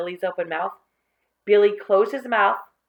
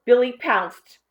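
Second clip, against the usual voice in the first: plain reading voice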